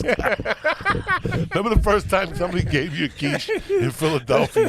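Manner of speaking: ordinary talk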